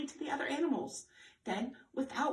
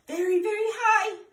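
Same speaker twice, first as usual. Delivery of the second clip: rising high; 9 semitones higher, 10 dB louder than usual. Acted. Reading to kids, voice rising as Giraffe stretches high. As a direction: voice climbs higher and louder on very high.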